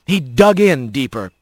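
usual speaking voice

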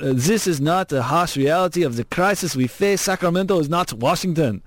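mock-Austrian politician impression; bad Austrian accent. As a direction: mimic the governor's speech in a bad Austrian accent. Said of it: "it's my bad accent".

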